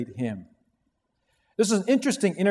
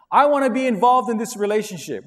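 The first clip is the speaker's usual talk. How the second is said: pleading believer; 5 semitones higher, 6 dB louder than usual. Retold or imitated. Acted dialogue with God.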